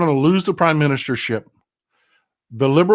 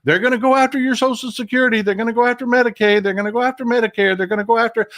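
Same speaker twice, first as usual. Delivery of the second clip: retold scream; 8 semitones higher, faster than usual. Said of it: "remember me screaming into this microphone".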